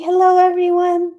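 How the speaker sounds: high, squeaky voice for the dog; dog's voice. Pretend. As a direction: speak for the dog in a tiny voice. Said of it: "תגידי".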